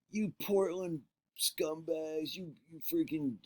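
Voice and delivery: high, slow mock jeer from the stands; jeering crowd. Rival fans taunting the Portland wrestlers, high slow mock jeer. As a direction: sneer it like a hostile fan.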